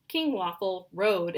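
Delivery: usual speaking voice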